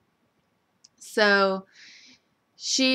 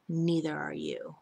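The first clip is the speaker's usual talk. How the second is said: much softer, lower cold delivery; soft cutting line; 3 semitones lower, 7 dB quieter than usual. Retold or imitated. Rafe dismissing Sammy, soft and cold. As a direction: say it quietly, straight at her. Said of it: "the way he said that".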